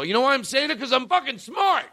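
high, insistent boast; Martin Luther King. Acted. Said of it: "if Martin Luther King would have said".

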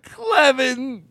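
very high, drawn-out squawk; comic high strangled cry; strained high-pitched name. Pretend. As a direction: squawk each syllable out high and slow.

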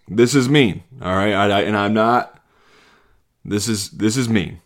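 ordinary talk, nothing marked

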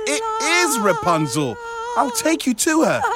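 high, squeaky horse voice. Acted. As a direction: speak as an excited little horse.

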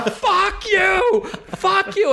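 very high mock-furious yell; hopping-mad heckler. Kid jumping up and down at comic, mock-mad yell. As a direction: imitate a kid's tantrum, pitch right up, volume held back. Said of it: "the expression hopping mad".

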